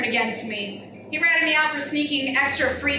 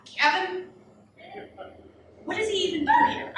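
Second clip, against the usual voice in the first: mocking; 5 semitones higher than usual. Mocking aside about Kevin.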